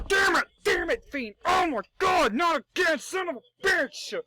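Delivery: high, loud mock tantrum; pissed-off outburst. Host imitating Randy cursing The Fiend, furious mock shout. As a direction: shout it like a furious wrestler who got cheated. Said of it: "And Randy's pissed".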